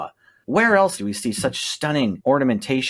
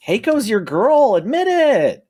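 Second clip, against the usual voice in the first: excited; 10 semitones higher, 4 dB louder, slower than usual. Real, not acted. Teasing friend in card debate.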